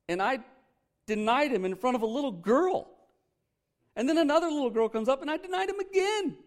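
high, rising, exasperated Peter confessing; Peter voiced. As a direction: voice Peter's disbelief at his own denials. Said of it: "I can just hear him saying that".

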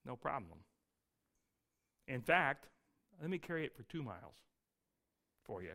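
a follower gently offering to carry the soldier's pack; kind offer. Retold or imitated. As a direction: offer to help, soft and kind. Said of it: "My followers say".

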